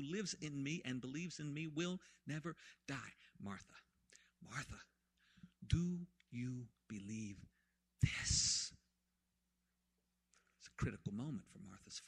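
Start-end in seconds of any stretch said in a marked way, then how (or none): 3.4-8.7 hushed question. Acted. Jesus asking Martha softly.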